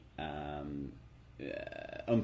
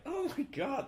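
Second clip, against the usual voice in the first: retold astonished gasp of words, much higher; slower than usual. Podcaster recalling looking up the actor, astonished. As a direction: act out being floored, pitch jumping up. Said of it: "completely by surprise".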